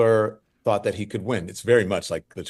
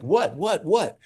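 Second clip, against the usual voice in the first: high, startled "what"; surprised disbelief; 10 semitones higher, slower than usual. Retold or imitated. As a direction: react as if you missed the news. Hearing he won, unaware.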